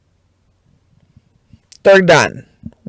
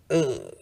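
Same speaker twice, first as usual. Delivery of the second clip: annoyed groan; 7 semitones lower, 15 dB quieter than usual. Retold or imitated. Annoyed at the trash again.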